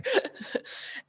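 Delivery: high unvoiced wheeze of laughter; breathless giggle; breathy laugh. Real, not acted. Still laughing.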